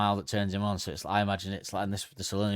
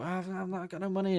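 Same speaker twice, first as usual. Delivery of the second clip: high pleading tourist; 9 semitones higher, 4 dB quieter than usual. Pretend. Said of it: "I go".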